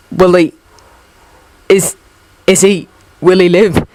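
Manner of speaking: halting, broken question; anxious gasping. A narrator's reading. Pale godfather asking after the boy, halting gasps. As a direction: stammer it out between long pauses. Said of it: "he gasped".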